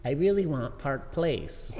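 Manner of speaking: lightly voiced wheedling wife; badly imitated wife asking sweetly; terrible wife impression. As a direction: coax slowly, pitch swooping up. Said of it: "This is terrible, uh, impression".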